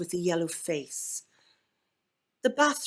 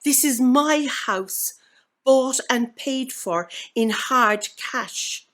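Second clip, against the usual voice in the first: loud and higher, slowing on the last line; shout; 5 semitones higher, 6 dB louder than usual. Acted. The trapped ghost of Miss Finch shouting at the new owners, loud. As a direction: bellow it up from the locked basement. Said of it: "I heard her shout".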